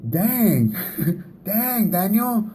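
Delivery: high, hammed-up mock groan with a laugh; joking exasperation. Tired husband joking back at his wife at the door, mock exasperated. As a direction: ham up a tired husband's complaint. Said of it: "No, I'm kidding".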